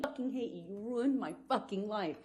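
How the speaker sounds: normal talking voice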